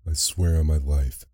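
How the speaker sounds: ordinary delivery, nothing marked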